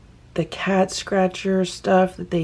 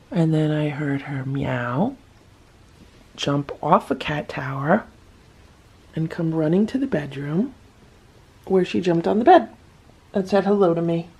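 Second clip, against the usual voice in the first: usual speaking voice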